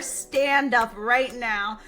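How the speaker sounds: drawn-out, very slow mock order; imagined stern command. Viewer wanting to shake some sense into the heroine, slow stern order. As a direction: stretch each word like an exasperated demand. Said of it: "I wanted to tell her".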